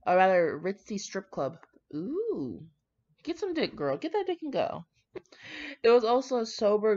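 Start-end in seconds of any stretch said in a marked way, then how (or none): 1.9-4.8 put-on high, squealing hype girl cheer; egging him on like a hype friend; mock cheer. Reader hyping up the groom at the strip club, high squealing cheer.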